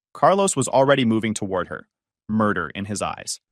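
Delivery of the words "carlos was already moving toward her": usual speaking voice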